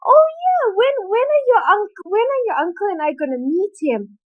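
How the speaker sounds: high, probing aunt voice; pry politely. Retold or imitated. Aunt asking when they will meet him, high and prying. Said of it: "she's like".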